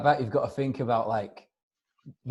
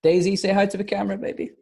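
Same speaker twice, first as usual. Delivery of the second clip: higher, louder coo to the dog; talking to a dog in a higher, louder cooing voice; 8 semitones higher, 4 dB louder than usual. Real, not acted. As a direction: sweet-talk the puppy for the camera. Greeting her dog on camera.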